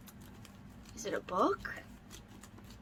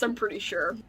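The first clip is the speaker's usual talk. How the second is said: normal talking voice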